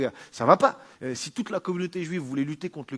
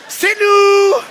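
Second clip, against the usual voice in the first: excited shout; 15 dB louder than usual. Acted.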